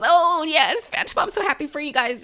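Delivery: lightly voiced Donna, overly cheerful; imitating Donna. Donna congratulating the couple, high gushing mock voice. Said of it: "If Donna goes".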